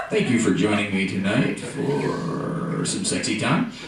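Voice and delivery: low, smooth captain's announcement voice; deadpan pilot announcing the date. Acted. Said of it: "they always talk like that".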